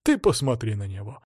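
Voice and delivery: plain reading voice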